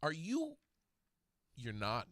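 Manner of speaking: ordinary talk, nothing marked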